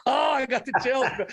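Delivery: thrilled by the memory; moved, excited. Real, not acted.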